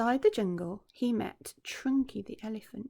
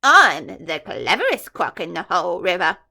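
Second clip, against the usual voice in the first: louder proud crocodile voice; boastful big croc; 2 semitones lower, 11 dB louder than usual. Acted. Enormous croc answering the taunt, loud and proud. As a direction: puff up and insist you're the smartest. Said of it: "the enormous crocodile answered".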